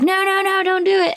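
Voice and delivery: high, pleading mock panic; imitated freak-out. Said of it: "it's just like freaking you out".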